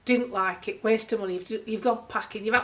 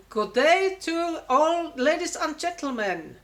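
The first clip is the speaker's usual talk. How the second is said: slow, higher, overly formal tannoy greeting; mock announcer; 6 semitones higher, 3 dB louder, slower than usual. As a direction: stretch it out like a stiff ship announcement. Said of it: "they speak in every language but English".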